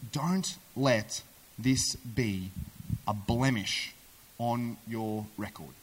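very slow, low, phrase by phrase; solemn and deliberate. Acted. Wife warning David about his future, solemn and deliberate. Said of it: "What she says next is so powerful".

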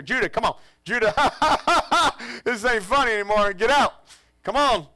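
high, loud, getting worried; anxious calling. Retold or imitated. Father calling missing son, loud and worried. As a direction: call him out, no longer joking.

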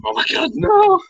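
high, loud shriek of dismay as the game goes wrong; horrified no; panicked cry. Real, not acted. Game disaster.

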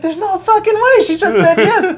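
high-pitched, excited exclaiming with laughter; giddy disbelief. Acted. Teller relives realizing she said yes, giddy and high. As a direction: crow it high, barely believing your luck.

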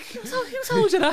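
high, laughing disbelief; baffled. Retold or imitated. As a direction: ask who spread it, half laughing. His own baffled question retold.

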